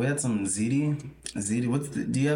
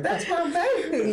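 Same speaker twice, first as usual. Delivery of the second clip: high, slow doting grandma; slower than usual. Retold or imitated. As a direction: coo it like a proud grandma. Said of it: "Grandma's podcast, like".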